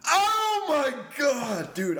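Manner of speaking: loud, high, drawn-out "oh my God"; amazed outburst. Real, not acted. Friend finally gets an old saying, amazed shout. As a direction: shout it slowly as it finally dawns on you. Sudden realization of the saying's meaning.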